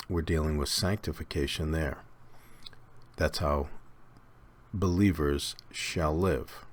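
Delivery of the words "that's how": ordinary talk, nothing marked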